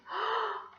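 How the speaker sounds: sharp high gasp of delight. Acted. Teacher sees the sock.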